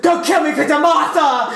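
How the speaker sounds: high, laughing mock plea; Superman. Friend mocking the Martha scene, high mock plea. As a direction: cry out a hammed-up plea. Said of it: "And Superman's like".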